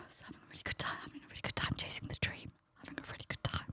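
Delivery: barely-there whisper to herself; despairing whisper. Retold or imitated. Comedian consoling herself after a drink was thrown, despairing whisper. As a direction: whisper it like a hollow self-reassurance. Retells despairing mutter on stage.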